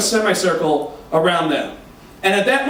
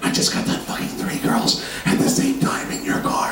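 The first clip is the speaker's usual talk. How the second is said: whisper; 4 dB quieter than usual. Retold or imitated. Confessing to Jay.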